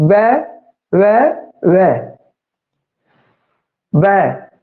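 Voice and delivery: shape each ਵੈ with the lips; slow sound demo. Shown as a demonstration. Teacher demonstrating the lip sound ਵੈ. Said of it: "ਵੈ ਦਾ ਉਚਾਰਨ ਕਰਕੇ ਦੇਖੀਏ".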